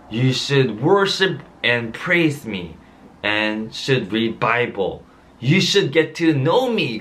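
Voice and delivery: very slow, measured English, word by word; slow solemn quote. Retold or imitated. Pastor quoting God's words to his son, very slow and solemn. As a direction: spell out God's words slowly to the congregation.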